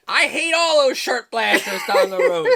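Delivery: play-grumble about the neighbours, breaking into laughter; mock feud grumble. Feuding neighbour complaining about the family down the road, laughingly indignant.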